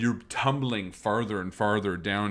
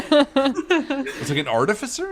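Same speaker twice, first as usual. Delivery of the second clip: laughing high question; 9 dB louder than usual. Real, not acted.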